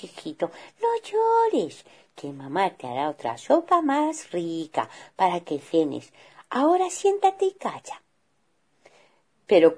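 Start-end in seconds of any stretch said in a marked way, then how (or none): 0.0-8.0 calm the crying cub gently; mother bear hushing her son; soothing mother. Acted.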